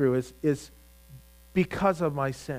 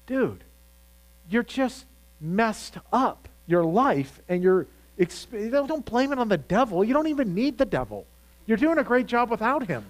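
higher, exasperated straight talk to a churchgoer; blunt mock rebuke; 6 semitones higher than usual. Preacher calling out a man who blames the devil, higher and exasperated. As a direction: tell him off like a frank friend, half joking. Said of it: "I just want to say to that person".

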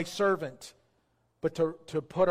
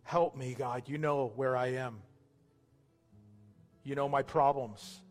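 subdued prayer in short phrases, long pauses between; slow guided prayer; 3 semitones lower than usual. Real, not acted. Pastor leading new believers through a prayer, slow and subdued. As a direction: pray slowly, leaving room for others to repeat. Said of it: "I'm gonna pray slow".

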